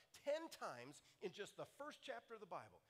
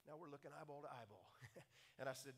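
normal talking voice

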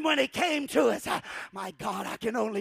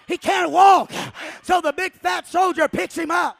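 loud, building sermon storytelling; fiery preaching; 5 semitones higher, 10 dB louder than usual. Acted. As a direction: preach the climax with fire. Preaching at full force.

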